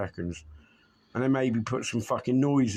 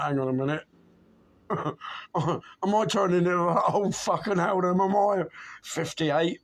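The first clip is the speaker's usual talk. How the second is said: higher, rising worried self-questioning; alarmed self-question; 6 semitones higher than usual. Retold or imitated. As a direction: ask yourself in mock alarm. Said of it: "And I'm like".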